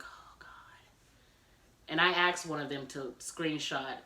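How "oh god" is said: hushed dismayed whisper; whispered groan of dread. Retold or imitated. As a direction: breathe it in despair. Learning husband posted on Facebook.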